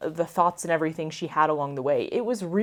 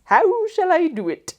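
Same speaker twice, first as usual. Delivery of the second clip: mock formal; 10 semitones higher, 5 dB louder than usual. Mock-formal rephrasing, laughing.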